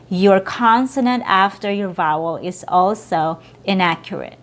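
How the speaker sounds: usual speaking voice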